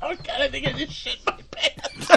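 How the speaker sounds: high breathless laughing; gasping through helpless laughter; laughing through pain. Real, not acted. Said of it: "my stomach hurts from laughing".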